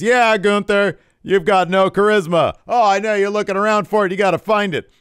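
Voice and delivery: loud, high brash trash talk; mock LA Knight. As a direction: boast it to the crowd. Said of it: "And Knight says".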